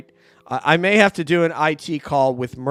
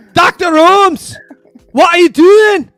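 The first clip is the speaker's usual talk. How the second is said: much higher, louder and slow mock cry; hammed-up exclaimed quote; 15 dB louder, slower than usual. Host joking about a Greek Sherlock, high slow mock cry. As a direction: stretch it out, high and loud, like a startled sidekick.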